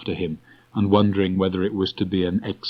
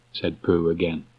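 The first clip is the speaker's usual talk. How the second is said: plain reading voice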